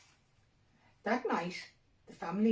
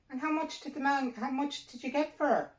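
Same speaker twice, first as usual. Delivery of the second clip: higher, sharp demanding wife; 9 semitones higher, 6 dB louder than usual. A narrator's reading. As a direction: she presses him for the price. Wife questions Mick.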